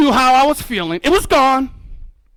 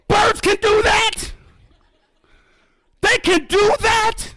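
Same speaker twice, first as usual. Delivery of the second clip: very high, drawn-out amazement; hammed-up high-pitched disbelief; astonished; 10 semitones higher, slower than usual. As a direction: stretch it out slowly, high with wonder. Retelling amazed reaction to osprey.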